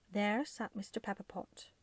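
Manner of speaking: ordinary delivery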